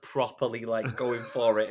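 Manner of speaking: usual speaking voice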